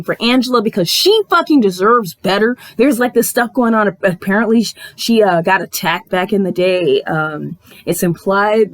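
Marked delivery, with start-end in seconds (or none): none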